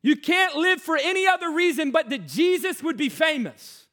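loud, high, driving sermon voice; full-force preaching. Acted. Preacher driving home the call to surrender, loud and high. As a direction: preach it hard to the crowd.

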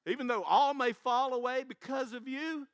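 loud, proud boast; Peter's boast. Retold or imitated. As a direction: brag it out big and loud. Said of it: "And Peter goes".

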